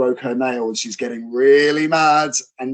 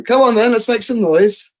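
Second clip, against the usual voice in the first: higher, louder call to the crowd; crowd-rousing call; 9 semitones higher, 7 dB louder than usual. Retold or imitated. Wrestler turning to the crowd demanding noise. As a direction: rouse the arena. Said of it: "turn my back to my opponent and go".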